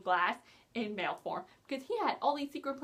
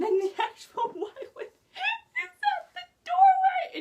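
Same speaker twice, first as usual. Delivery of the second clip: much higher and louder, slow, laughing through; 8 dB louder, slower than usual. Real, not acted. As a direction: squeal it out mid-laugh. Laughing telling the funny reveal.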